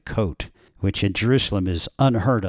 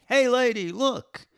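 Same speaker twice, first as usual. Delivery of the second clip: high mock shout at the lady. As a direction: yell it out like a flasher.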